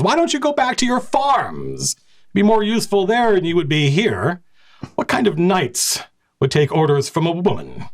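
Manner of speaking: high, sneering knight voice; taunting knight. Acted. Knight commander jeering at the assembled Red Falcons, mocking. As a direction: jeer at them like farmhands.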